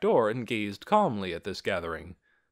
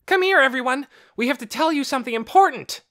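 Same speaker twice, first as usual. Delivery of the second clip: loud, fast, urgent call; excited calling out; 9 dB louder, faster than usual. A narrator's reading. Boy calling everyone with urgent news. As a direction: shout the news to the whole house. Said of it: "he shouted".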